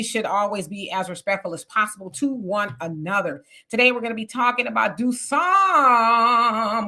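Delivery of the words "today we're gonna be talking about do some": slow, louder, with some drawn out; stress on some. Shown as a demonstration. Host introducing today's topic, slow, emphatic some. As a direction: drag out some to make the point. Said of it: "with the emphasis on some".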